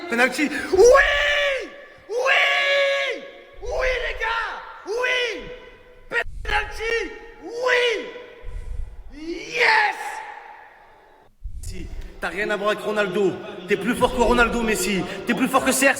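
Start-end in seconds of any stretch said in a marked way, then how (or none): 0.7-10.0 high, triumphant yelling; ecstatic cheering. Real, not acted. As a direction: scream with joy at the penalty. Celebrating a penalty.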